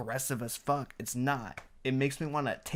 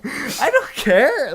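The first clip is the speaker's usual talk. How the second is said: laughs, then much higher and louder, slow; laughing dismissal; 11 semitones higher, 9 dB louder, slower than usual. Real, not acted. Host laughing off his cohost's guilt over the harsh review. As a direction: brush it off mid-laugh.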